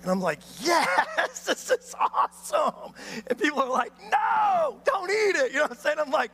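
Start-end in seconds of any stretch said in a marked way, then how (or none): 0.6-2.9 high, laughing delight; thrilled at the iguana; mock giddy excitement; gleeful yes. Retelling his own excitement at iguana. 4.1-5.5 high, loud "No!"; imitating alarmed people; warning shout.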